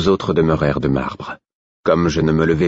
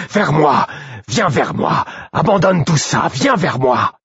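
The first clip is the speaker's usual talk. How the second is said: high, desperate calling; Œil de Nuit, desperate howl; 11 semitones higher, slower than usual. A narrator's reading. Wolf begs companion to come to him, desperate howl. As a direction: plead at a high-pitched cry. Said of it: "hurla Œil de Nuit avec désespoir".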